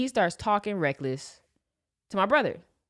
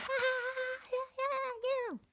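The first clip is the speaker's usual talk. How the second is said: put-on high, squeaky crowd of kids egging him on; mock cheering kids, much higher; 8 dB quieter than usual.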